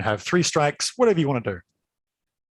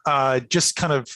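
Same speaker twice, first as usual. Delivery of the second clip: ordinary talk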